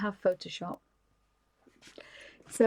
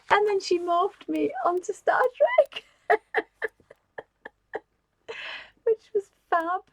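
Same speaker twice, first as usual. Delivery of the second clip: laughing; 10 semitones higher than usual. Real, not acted. Laughing while telling about card.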